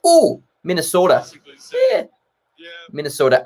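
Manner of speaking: hammed-up Minnesota accent. Shown as a demonstration. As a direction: try a lilting Minnesota voice. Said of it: "I can't do impressions to save my life".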